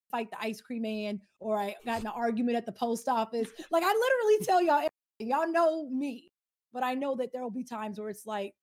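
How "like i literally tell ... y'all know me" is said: excited emphasis. Real, not acted.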